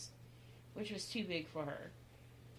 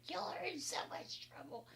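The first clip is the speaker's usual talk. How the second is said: high, voiceless mock-mom scolding; 11 semitones higher, 4 dB quieter than usual. Daughter acting out Mom finding the kids, mock scolding. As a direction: imitate Mom's warning without raising volume. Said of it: "And then Mom was like".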